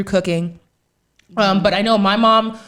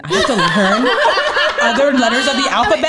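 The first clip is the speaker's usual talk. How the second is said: louder, laughing mock rant; exasperated mom; 5 semitones higher, 5 dB louder than usual. Mom complaining to her kid about new terms, exasperated.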